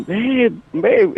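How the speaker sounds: high, pleading "babe, babe"; mimicked screaming girlfriend. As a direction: call out for him, panicked. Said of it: "she's all screaming".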